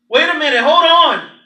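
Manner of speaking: loud, high cry of alarm; father crying out as his son is grabbed; alarmed protest. Acted. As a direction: shout to stop them taking your boy.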